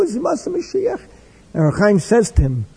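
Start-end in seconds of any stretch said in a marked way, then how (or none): 0.0-1.0 much higher, an innocent what-did-I-say plea; hammed-up indignant innocence; defensive protest.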